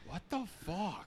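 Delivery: hushed, slowed mock stranger; a quiet baffled stranger, drawn out; stranger's reaction. Random guy reacting to loud pair.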